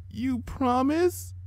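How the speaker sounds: put-on high, soft, slow whimper of a sick child; a weak little filly shielding her eyes; small sick-child voice. A narrator's reading.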